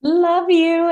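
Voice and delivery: high, loud love you; affectionate reply. Real, not acted. Guest returning host's love you, excited. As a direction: beam it right back.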